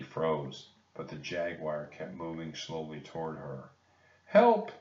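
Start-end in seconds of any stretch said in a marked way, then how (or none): none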